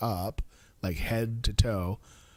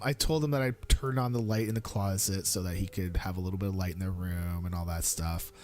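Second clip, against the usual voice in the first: ordinary talk, nothing marked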